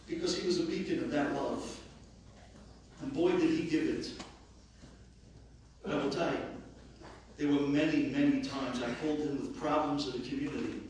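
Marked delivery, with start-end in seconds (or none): none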